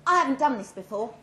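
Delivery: nervous blurt, tumbling out. Acted. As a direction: let it burst out before you can stop it. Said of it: "I blurted out".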